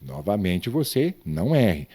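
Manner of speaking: ordinary talk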